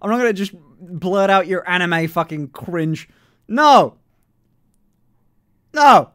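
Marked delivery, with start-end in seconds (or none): 3.5-6.1 shouted high no; playful loud protest; loud refusal. Real, not acted. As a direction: yell it out to refuse. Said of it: "refusing to do the voice".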